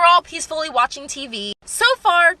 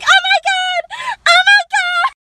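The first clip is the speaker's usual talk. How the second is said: very high, loud mock shriek; slow, squealed hammed-up panic; imitating panicking mom; 7 dB louder, slower than usual. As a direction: wail it like a horrified mother. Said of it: "my mom's in the background like".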